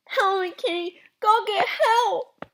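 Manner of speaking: high, garbled plea; mouth stuck. Acted. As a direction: beg for help with lips frozen in place. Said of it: "sounding like he had something in his mouth".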